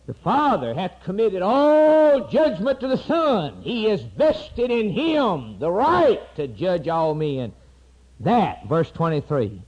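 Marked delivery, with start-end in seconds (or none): 0.1-7.5 higher, louder, slow preaching; fiery preaching. Acted. Preacher reading the verse aloud to the church, high and loud. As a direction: read the verse as a proclamation.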